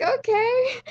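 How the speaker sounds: high, loud, pleased okay; gleeful mock agreement; delighted okay. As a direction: squeal a happy okay at the bargain. Retold accepting the cheap fare.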